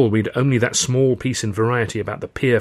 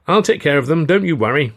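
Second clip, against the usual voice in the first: higher, louder promise; hearty reassurance; 7 semitones higher, 8 dB louder than usual. Acted. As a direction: reassure them heartily as the train leaves. Friend reassures at train departure.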